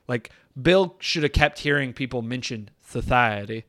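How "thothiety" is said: "society" with a lisp, s sounds turned to th. Pretend. Host talking about the movie's cult language, lisped "society". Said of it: "Why do you have a little lisp".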